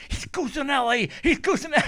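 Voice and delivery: excited friend on the phone, shouting the player's name. Retold or imitated. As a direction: announce "Cusinelli" like a big signing. Tim excitedly announcing the recruit.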